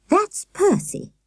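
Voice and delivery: high, slow lady introducing her parrot; high old lady voice. A narrator's reading. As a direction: voice a fluttery elderly hostess, slowly. Miss Hilliard introduces her parrot.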